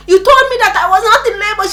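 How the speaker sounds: high, fast protest; frantic accusation. Retold or imitated. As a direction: complain loudly through acted pain. Re-enacts accusing the nurse in pain.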